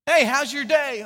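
a chipper hey to a friend; cheery greeting. Retold or imitated. Friend greeting someone cheerfully.

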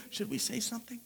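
soft, tentative aside; hushed thought. Acted. Nicodemus thinking as he looks at Joseph, hushed. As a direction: murmur it so the group won't hear.

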